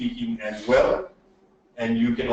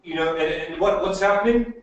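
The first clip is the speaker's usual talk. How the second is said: mock nervous umming and hedging; hesitant filler sounds; 5 semitones higher than usual. Shown as a demonstration. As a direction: stumble through fillers like a nervous speaker. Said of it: "that you're going".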